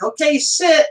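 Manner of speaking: playful trainer voice; mock trainer command. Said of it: "they're like".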